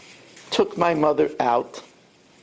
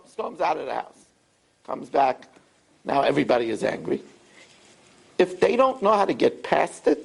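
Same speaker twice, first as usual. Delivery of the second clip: ordinary talk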